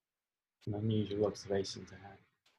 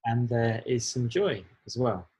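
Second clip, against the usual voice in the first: louder and slower, clearly said; louder repeat; 6 dB louder, slower than usual. Real, not acted. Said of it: "can you repeat that".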